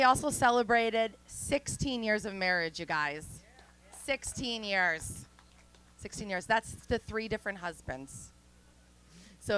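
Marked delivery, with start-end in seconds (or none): 4.0-5.2 drawn out. Pretend. Stretching the number for effect.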